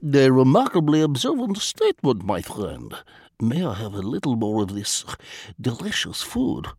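put-on lightly accented, formal and unhurried Vladimir; a polite spy choosing words with care; foreign diplomat voice. A narrator's reading.

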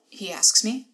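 normal talking voice

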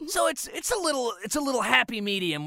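a high, slightly raspy cat voice; Rocky voice. Shown as a demonstration. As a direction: lift it high with a little rasp. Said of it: "this is what the character sounds like".

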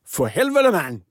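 amateur mock-Danish accent, high start falling away; Danish impression. Shown as a demonstration. Co-host asked how Danish sounds, Danish impression. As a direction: curse in a rough attempt at Danish. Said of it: "hur låter nu danska då".

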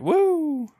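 high, bright whoop of joy; excited cheer. Acted. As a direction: cheer it out like a fan. Mock cheer for disappointing reprint.

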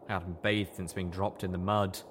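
ordinary delivery

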